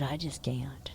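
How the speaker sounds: whispered, worn out; exhausted whisper. Retold or imitated. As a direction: barely breathe it out. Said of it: "I said".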